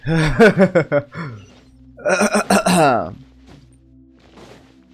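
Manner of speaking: loud burst of laughter; hearty laugh. Real, not acted. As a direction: laugh out loud at your own failure. Laughs at own failed attempt.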